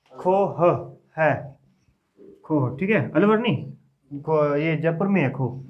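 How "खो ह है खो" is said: खो ह है in slow separate pieces; spelling out. Shown as a demonstration. Teacher spelling a peak's name for students, slow and separated. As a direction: give खो ह है sound by sound, pausing between.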